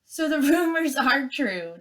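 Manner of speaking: mocking laugh through the words. Nettle laughing at the gosling, high mocking laugh. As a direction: scoff and laugh, high and loud. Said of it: "Nettle laughed".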